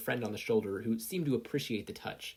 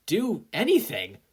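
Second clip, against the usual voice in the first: loud, higher and slowed for anything; cheering climax; 10 semitones higher, 9 dB louder, slower than usual. Acted. Sero finishing his hype to the class, loud and slow. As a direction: land the cheer hard on anything. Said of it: "Sero cheered".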